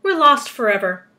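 loud cry of doom; acted storybook shout. A narrator's reading. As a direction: shout it out as hopeless. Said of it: "shouted the ladybug".

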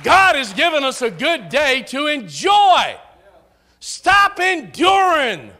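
full-force preaching peak; preaching shout. Real, not acted. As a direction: cry it out to the congregation. Preacher exhorting congregation.

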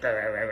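low mock grumbling growl through a laugh; gremlin growl. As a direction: play her as a growling little gremlin. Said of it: "you're like".

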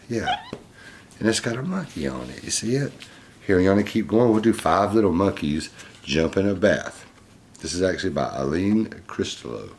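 plain reading voice

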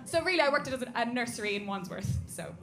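normal talking voice

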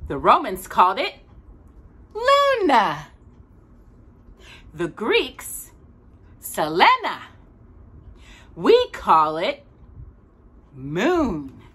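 storybook voice for a little listener; gentle read-aloud narration; reading to a child. A narrator's reading. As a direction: read it like a bedtime picture book. Reads picture book to child.

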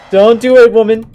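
high, loud plea; desperate shout. Real, not acted. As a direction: yell at her to stop. Yelling at animatronic.

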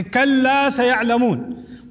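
"kalla saya'lamun" run together as one phrase; linked, continuous "kalla saya'lamun"; no pause, in flow. Shown as a demonstration. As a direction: carry "kalla" straight into "saya'lamun" without stopping. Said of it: "if you say kalla in flow, without stopping".

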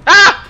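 sudden high, loud shriek; startled scream. Real, not acted. Player scared by a door, startled scream. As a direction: scream in fright.

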